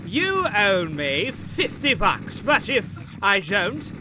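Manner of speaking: slow, deliberate parody of proposing a bet; hammed-up, measured bargaining voice; mock formal wager. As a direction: spell out the terms with drawn-out ceremony. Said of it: "It's not like I said".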